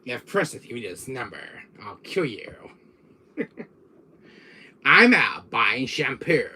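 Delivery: slow villain answering-machine message; menacing villain drawl, swelling on the punchline; Wesker. Acted. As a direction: deliver a phone greeting as a haughty villain. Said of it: "on my answer machine as Wesker".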